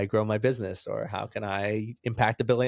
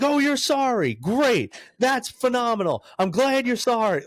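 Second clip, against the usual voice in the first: mock sarcasm, much higher and louder; hammed-up cop sneering at the apology; sarcastic cop; 14 semitones higher, 5 dB louder than usual. Cop mocking the driver's apology, high sarcastic squeal. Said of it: "He's like".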